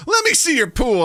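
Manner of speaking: high, pushy mock-guest voice; imitating an insistent guest; demanding pimp. Pimp makes another demand.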